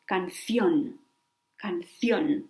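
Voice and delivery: "canción" stressed on the final CIÓN, then falling; careful Spanish demo of "canción", can-CIÓN; stress on last syllable. Shown as a demonstration. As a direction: lean on the accented O in "canción". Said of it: "goes in the last, uh, O".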